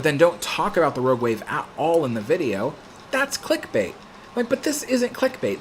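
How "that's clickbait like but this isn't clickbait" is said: exasperated. Real, not acted. Defending his thumbnail to the troll.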